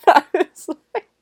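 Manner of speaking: cracking up mid-sentence; high, loud burst of laughter through the words; laughing. Real, not acted. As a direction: try to talk while laughing. Cracks up at the awkward line.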